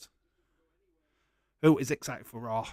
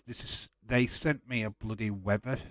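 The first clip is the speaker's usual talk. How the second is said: ordinary delivery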